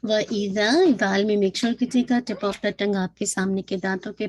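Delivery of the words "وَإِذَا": normal talking voice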